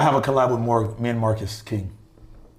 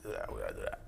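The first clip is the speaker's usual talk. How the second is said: deep voice; 16 dB quieter, faster than usual. Pretend. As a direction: drop the voice way down. Repeats line in put-on voice.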